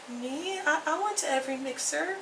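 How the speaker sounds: realizing the award is about her; stunned hush. Retold or imitated. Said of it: "I was like".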